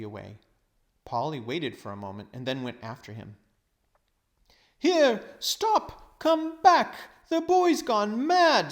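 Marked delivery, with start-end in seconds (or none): 4.8-8.7 put-on high, loud panicked old man yelling; alarmed shout. Uncle Andrew shouting at the children to come back, panicked. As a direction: call after the running children in a panic.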